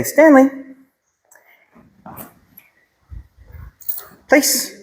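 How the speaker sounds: sing-song call to the dog; high-pitched dog command. Shown as a demonstration. Trainer calling the dog to his place. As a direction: call the name high and bright, then the command. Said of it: "And so if I say".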